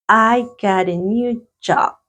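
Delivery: slow, careful English sentence; slow teacher dictation; slow dictation reading. Shown as a demonstration. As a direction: read it slowly for students to repeat. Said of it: "Number two".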